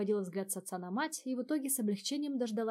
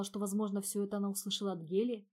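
ordinary delivery

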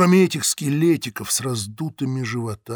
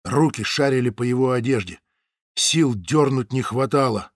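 ordinary delivery, nothing marked

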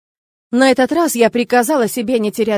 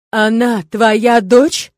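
demon voice; 7 dB louder, much slower than usual. Acted. Demon speaking through narrator.

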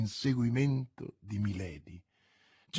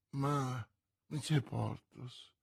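soft murmured protest; quietly murmured plea; 7 dB quieter, slower than usual. A narrator's reading. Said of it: "mormorò la procuratrice".

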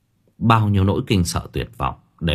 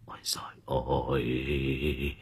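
feeble whimpering; 7 semitones lower, 13 dB quieter, slower than usual. A narrator's reading. Shaken master moans to his servant, feeble and faint. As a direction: let the words drag out weakly, barely any voice. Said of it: "Lão thều thào".

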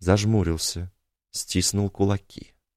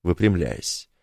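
usual speaking voice

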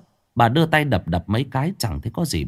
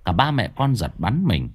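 ordinary delivery

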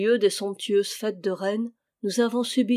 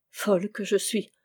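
plain reading voice

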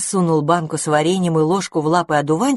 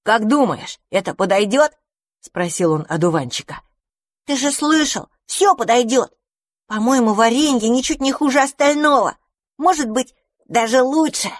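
higher, lively storybook character; raised character voice; 7 semitones higher than usual. Acted. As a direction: voice the little creature brightly. Mouse-enemy and dandelion talking.

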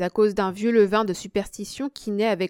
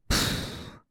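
long voiceless puff of air; discouraged puff; 3 dB quieter than usual. A narrator's reading. Losing player at cards, discouraged puff. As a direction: blow out a discouraged breath. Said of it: "dit-il découragé".

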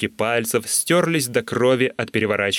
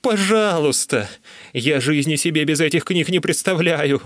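pitched-up, pleading whine; whining plea; 6 semitones higher than usual. A narrator's reading. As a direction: beg like a whimpering addict. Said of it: "заскулил я".

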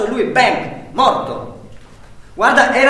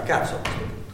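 unvoiced, under the breath; whispered curse; 6 dB quieter than usual. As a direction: hiss it to yourself. (imprecazione sussurrata)

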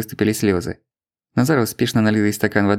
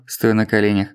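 ordinary delivery, nothing marked

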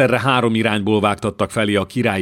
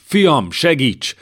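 desperate plea; 6 semitones higher, 4 dB louder, much slower than usual. Acted. Wounded father begs his son.

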